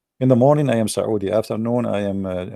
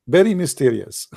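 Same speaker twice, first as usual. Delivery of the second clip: ordinary delivery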